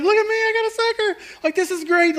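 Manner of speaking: put-on very high, squealing child voice; delighted little girl. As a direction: gloat happily in a little kid's voice. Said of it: "she's so happy with the sucker".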